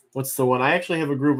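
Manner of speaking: ordinary talk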